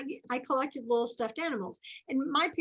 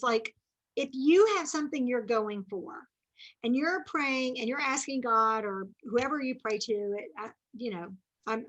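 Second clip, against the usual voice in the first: usual speaking voice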